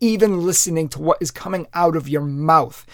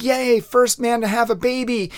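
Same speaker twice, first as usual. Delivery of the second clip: mock cheering; 6 semitones higher than usual. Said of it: "everybody was rejoicing".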